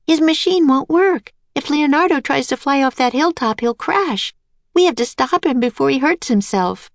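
put-on high little girl voice, urgent and worried; young girl Annie. A narrator's reading. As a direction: play Annie, anxious to stop him.

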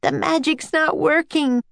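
put-on high, dismayed girl's voice; distressed cry. A narrator's reading. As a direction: cry it high in alarm to a friend. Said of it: "Annie cried to Jack".

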